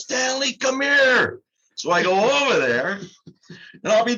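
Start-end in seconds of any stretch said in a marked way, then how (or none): 0.0-1.4 retold yell. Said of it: "opens the door and yells".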